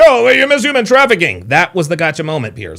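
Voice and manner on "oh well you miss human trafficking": high mock-accusing quote; exaggerated mock accusation; mocking imitation. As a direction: parody the interviewer's gotcha, pitched up. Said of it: "and you're like".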